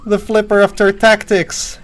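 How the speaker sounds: high, drawn-out triumphant yell; excited yelling. Real, not acted. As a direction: shout the grand title, too excited. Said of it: "Oh god, I'm yelling too much".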